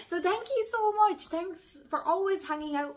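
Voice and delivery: on the verge of tears. Real, not acted. Thanking her subscribers, close to tears. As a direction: thank them while fighting back tears. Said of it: "I'm gonna start crying".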